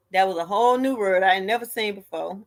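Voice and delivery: ordinary delivery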